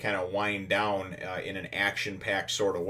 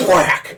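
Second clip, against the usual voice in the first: sudden loud, much higher impact cry; loud whack; 10 semitones higher, 14 dB louder than usual. Retold or imitated. Narrating Zoro's blindside hit with a loud whack. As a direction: slam the sound out like a punch.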